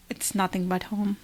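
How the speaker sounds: usual speaking voice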